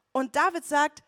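ordinary delivery

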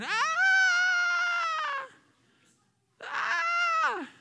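put-on long, high toddler wail; high-pitched mock tantrum shriek; imitated toddler scream. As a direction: mimic a screaming toddler with a sustained squeal. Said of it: "his little toddler is screaming".

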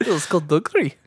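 tentative Scottish go at one word; Scottish accent. Pretend. Co-host joining the accent game, attempted Scottish. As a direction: try a little Scottish accent. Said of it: "Do you wanna do a Scottish accent".

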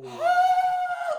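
very high held scream; retold scream of pain. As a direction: squeal out the burning pain. Retold scream while peeing.

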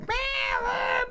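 high, held animal screech; owl speak. Retold or imitated. Owls communicate with Grimace, high owl call. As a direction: squeal out a mock owl call. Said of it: "through owl speak".

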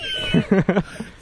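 very high squealing laugh; shrill laugh. Real, not acted. Laugh after demon joke.

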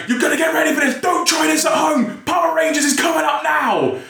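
high-pitched, shouted pump-up announcement; re-enacted rousing host's yell; Mister Motivator hype. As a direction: hype the crowd like a TV fitness motivator. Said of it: "Mister Motivator would pump us up".

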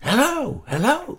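very high squeaky piglet voice; tiny pig squeaking a hello; high-pitched little pig greeting. Acted. Micro Pig calls again.

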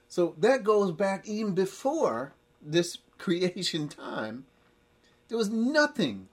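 preaching at full force. Acted. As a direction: drive it home to the congregation.

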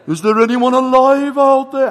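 put-on loud, drawn-out Welsh-accented search call; slow Welsh call at full volume. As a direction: holler slowly across water in an amateur Welsh accent. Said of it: "my Welsh accent isn't great".

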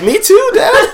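very high squeaky mock child; mock kid voice. Mocking Mike bonding with his bullied dad, squeaky high. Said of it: "Mike's like".

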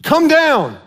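yelling crowd. Retold or imitated. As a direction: call it out like the crowd taunting him. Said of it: "The crowds yelled".

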